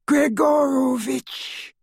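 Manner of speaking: very high, drawn-out villain voice; chilling high call, stretched out; high, cold voice. A narrator's reading. Dark wizard demanding a man at the door, high and cold. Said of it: "said a high, cold voice".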